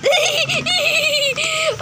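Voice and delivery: high loud burst of laughter; big laugh. Real, not acted. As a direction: laugh out hard. Laughing at movie.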